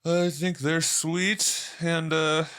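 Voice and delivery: put-on ironic sweetness; mock sincere. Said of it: "No, I'm just kidding".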